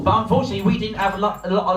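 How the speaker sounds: ordinary talk, nothing marked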